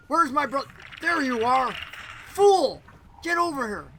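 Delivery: loud, high scolding; angry summons. Acted. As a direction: call out crossly to a wayward brother. Sister angrily summons her brother.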